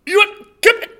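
high, loud Yorkshire "Hey up"; hammed-up Yorkshire farmer offering tea; Yorkshire accent. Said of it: "this is how I get back into the Yorkshire accent".

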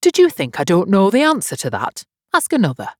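high, loud boastful challenge; loud and triumphant, pitched high; crying out. A narrator's reading. Humpty challenges Alice to ask another, loudly triumphant. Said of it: "cried Humpty Dumpty".

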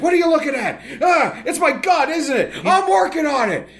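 defensive sketch character, very high and strained; hammed-up indignant outburst in a put-on voice; Middle-aged Man. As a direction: snap back defensively in a high comic voice. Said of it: "Mike Myers characters ... is a middle-aged man".

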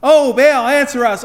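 high, loud hammed-up cry with a laugh; half-laughing imitation of frantic prophets; mock desperate yell. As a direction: yell it up at a deaf idol. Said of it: "they would yell".

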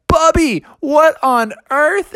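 high, incredulous call; slow, high-pitched amazement; astonished. A narrator's reading. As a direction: call out in disbelief. Siblings astonished at Bubby.

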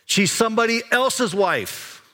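servant's pointed warning. Retold or imitated. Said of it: "what the servant says".

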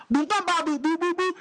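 high, punchy boom-bam sounds; mock gunfire noises. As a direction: spit out gunshot noises.

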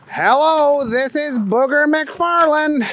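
high cartoonish character voice; Booger McFarland. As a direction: answer the phone in a high, goofy voice. Booger answers the phone.

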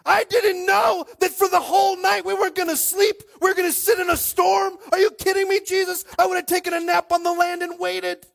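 high, loud, hammed-up complaint; mock tirade building to a full shout; indignant rant. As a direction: yell it like an outraged disciple. Acted rant of complaining disciples at Jesus.

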